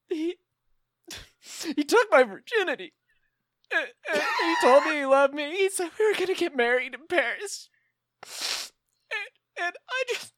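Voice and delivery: hammed-up tearful wail; melodramatic wail. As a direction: wail it like a soap opera heroine. Melodramatic high wailing story.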